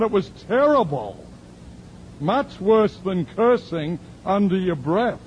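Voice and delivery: hint of a dramatic church lady; voicing the woman. The woman hinting at her worse sin, high and loud.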